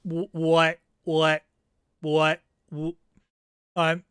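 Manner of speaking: normal talking voice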